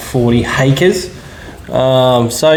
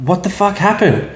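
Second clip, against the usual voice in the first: retold panicked demand; 9 semitones higher than usual. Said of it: "I was panicking".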